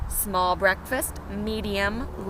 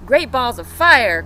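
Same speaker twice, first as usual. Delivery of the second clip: loud cry; 11 dB louder than usual. Acted. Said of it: "cried the goose".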